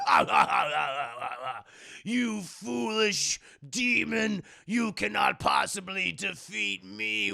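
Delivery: evil laugh, then slow grand taunt; hammed-up monster voice, drawn out; gloating villain. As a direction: taunt slowly like a cartoon villain. Acts reformed villain gloating at foe.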